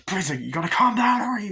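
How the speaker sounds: begging him to calm down; mock pleading. Man begs the crazed one to calm down, high loud pleading.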